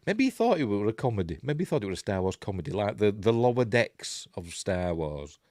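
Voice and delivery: ordinary talk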